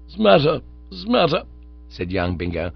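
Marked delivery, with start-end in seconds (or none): 0.1-1.4 higher, louder groggy mumble; half-awake slurred grumble; sleepy gurgle. Acted. Bingo woken by a thrown shoe, groggy gurgle.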